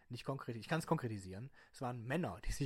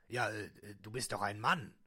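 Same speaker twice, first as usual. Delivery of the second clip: disguised deep voice; 3 semitones lower, slower than usual. Acted. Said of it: "meine Stimme zu verstellen".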